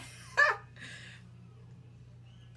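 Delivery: airy high-pitched nervous giggle; breathless high giggle. Real, not acted. Scared of spicy noodles.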